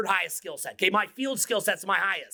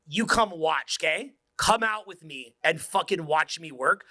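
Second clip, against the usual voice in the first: normal talking voice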